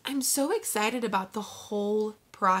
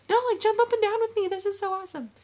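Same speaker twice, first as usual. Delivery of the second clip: much higher, fast and squealy; bouncy excitement; 12 semitones higher, faster than usual. Retold or imitated. Imitating herself begging her husband to jump with joy. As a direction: squeal like you can't stand still. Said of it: "I'm gonna be like".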